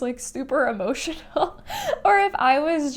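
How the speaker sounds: laughing while choking up; real emotional laugh. Real, not acted. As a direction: let a real laugh break through the tears. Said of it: "having a moment".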